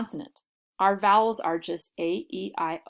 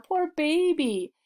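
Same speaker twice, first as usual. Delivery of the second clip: put-on higher and slow, babying tone; a touch of cooing pity; 8 semitones higher, slower than usual. Comforting a hurt friend, light cooing pity. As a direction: soothe them like a little one. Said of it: "kinda like saying".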